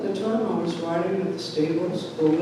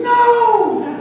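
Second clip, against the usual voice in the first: high, loud, drawn-out shriek; screaming mother; 8 dB louder than usual. Retold or imitated. Mother stopping father with the knife, screaming. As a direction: imitate mom screaming in protest. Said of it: "My mother's screaming".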